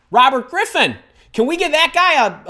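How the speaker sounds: excited call-out. Real, not acted. Calling out a viewer.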